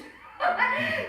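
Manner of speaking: sudden high peal of laughter; high loud laugh. Real, not acted. Laughs at her own stubbornness.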